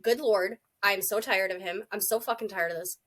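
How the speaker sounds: ordinary delivery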